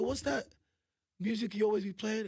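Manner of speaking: normal talking voice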